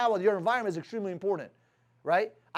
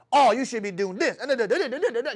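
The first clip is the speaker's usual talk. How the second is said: mock scolding; 2 semitones higher, 4 dB louder than usual. Big lady cursing out the waitress, mock scolding. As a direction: mimic a scolding that dissolves into nonsense syllables. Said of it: "Why is she screaming at her".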